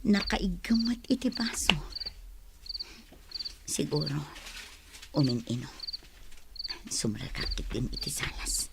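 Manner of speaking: a soft whisper that fades out to almost nothing; hushed whisper. Acted. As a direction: whisper so you won't wake anyone. Wife whispers at night, husband asleep.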